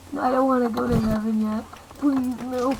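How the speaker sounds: ordinary talk, nothing marked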